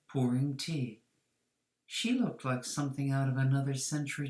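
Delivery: ordinary delivery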